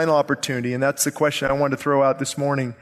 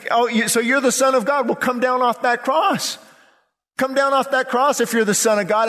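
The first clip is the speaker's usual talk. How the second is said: raised, sarcastic dare; mocking taunt; 7 semitones higher than usual. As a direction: imitate jeering scoffers calling up to the condemned. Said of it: "but they're saying".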